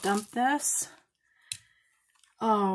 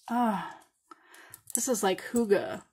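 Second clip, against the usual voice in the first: normal talking voice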